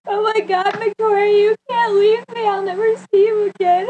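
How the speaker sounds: high, loud hammed-up wail; very high-pitched imitation of despair, ending in a gasp; mock distraught pleading. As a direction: beg a friend not to go, melodramatically. Acts out her distraught goodbye.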